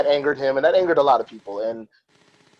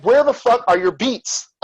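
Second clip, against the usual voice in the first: angry demand; 8 semitones higher, 3 dB louder, slower than usual. Retold or imitated. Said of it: "he was like".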